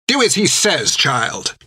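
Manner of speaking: put-on high, sharp old sister's voice; crooked old nun snapping an order; snapped. A narrator's reading. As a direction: snap it at the boy like a stern old nun. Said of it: "snapped Hilda".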